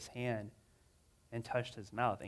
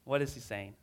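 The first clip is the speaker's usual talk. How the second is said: ordinary delivery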